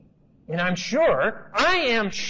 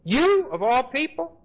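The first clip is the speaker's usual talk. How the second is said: incredulous Peter; 7 semitones higher than usual. Retold or imitated. Peter's indignant protest continues.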